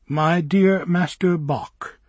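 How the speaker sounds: slow, formal dictation; as if dictating. A narrator's reading. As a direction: measure each word like a letter. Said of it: "as if dictating".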